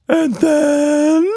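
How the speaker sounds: very high, loud little creature voice; a cheeky tiny monster, full of glee; Quatch Monster voice. Acted.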